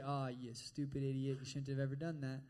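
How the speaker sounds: low, mildly disgusted self-scolding; self-reproach. Acted. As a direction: scold yourself under a mock cloud of shame. Acted self-reproach after giving in.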